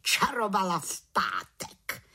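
drawn-out tattling of an aunt witch; piling on. A narrator's reading. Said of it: "přisolila si teta Bimbula".

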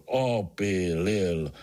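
"Opílil" very drawn out, insistent; mild roar. A narrator's reading. Artur repeats his word to Pája, mock roaring. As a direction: repeat the word slowly and stubbornly. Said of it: "zařval Artur".

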